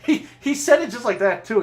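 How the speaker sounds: talking through loud high laughter; barely holding in laughter; laughing. Real, not acted. Telling friends about the scream, laughing loudly.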